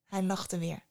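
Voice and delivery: ordinary delivery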